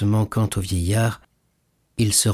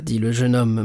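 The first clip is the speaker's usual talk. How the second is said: usual speaking voice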